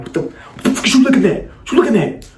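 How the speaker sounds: retold high-pitched confrontation; brother confronting. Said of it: "My brother like".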